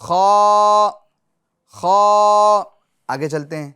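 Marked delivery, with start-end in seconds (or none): 0.0-2.6 خا said loud and full-mouthed; full heavy khaa. Shown as a demonstration. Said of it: "پور بھی کرنا ہے اس کو". (خا کو پُر اور موٹا پڑھیں)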